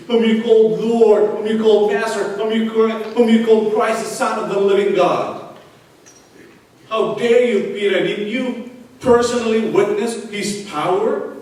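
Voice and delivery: loud, rising pulpit rebuke; preaching at full force. Acted. Preacher rebuking Peter before the congregation, loud and fiery.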